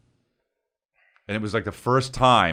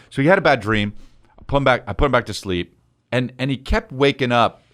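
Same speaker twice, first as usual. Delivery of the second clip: ordinary talk, nothing marked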